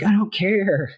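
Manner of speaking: high-pitched mock-angry retort; imitated angry outburst. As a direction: act out her exasperated snap, pitched high. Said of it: "she's so angry and so".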